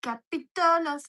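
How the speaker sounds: hammed-up announcer. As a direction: read the shoutout like a grand host. Reading shoutouts in put-on voice.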